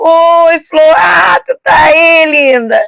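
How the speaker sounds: loud, delighted hello to a loved one; a mother calling out happily to her daughter; excited loud greeting. Acted. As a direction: greet her like you're thrilled to see her. Example of a warm, loud greeting.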